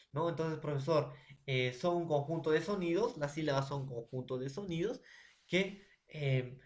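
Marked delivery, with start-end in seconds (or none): none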